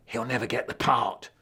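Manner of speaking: plain reading voice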